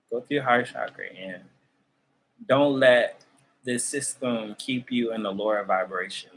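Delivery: ordinary delivery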